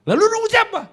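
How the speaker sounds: very high, loud mock yell; snappish father. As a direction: imitate dad snapping in a squeal. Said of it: "jangan nyolot".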